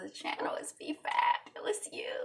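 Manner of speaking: breathy high whispered channel name; playful whispered intro. Acted. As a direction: whisper it like a secret.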